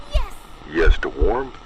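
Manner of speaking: ordinary delivery, nothing marked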